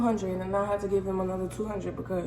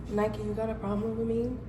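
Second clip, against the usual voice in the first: calm question; 8 dB quieter than usual. Retold or imitated. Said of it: "she didn't even say it on no hostile shit".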